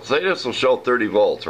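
ordinary talk

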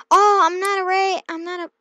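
loud, high put-on bravado; false bravado. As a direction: boast that you're not scared. Playing a horror game.

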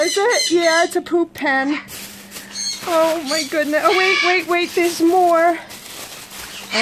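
ordinary talk, nothing marked

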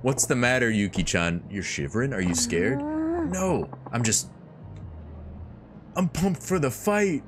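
put-on high, pumped young woman; high girl voice. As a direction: pitch up, bluffing bravado. Voicing Majima then Yuki.

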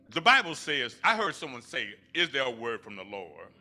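ordinary talk, nothing marked

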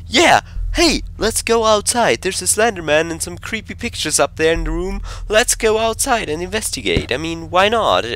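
high-pitched, mock-cheery sarcasm; sarcastic enthusiasm. Player mocks the game's order to go outside, sarcastic. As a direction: pitch it way up as if it were a great idea.

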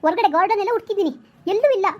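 building from worried to frantic; rising panic. Acted. Mother panics over missing daughter. (ಹೆಚ್ಚುತ್ತಿರುವ ಗಾಬರಿ)

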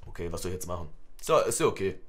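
ordinary delivery

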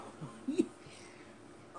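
high-pitched goofy laugh; involuntary dopey giggle; doofus laugh. Real, not acted. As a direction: let a silly, squeaky laugh slip out. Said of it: "Oh, the doofus laugh".

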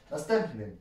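usual speaking voice

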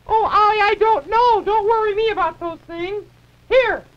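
loud, high and fed up; exasperated. Acted. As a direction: snap back, exasperated. Exasperated reply to nagging.

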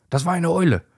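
high excited warning; alarmed cry. A narrator's reading. Hedgehog warning the dogs, alarmed and high. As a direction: cry out the danger. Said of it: "schreit Igor ganz laut".

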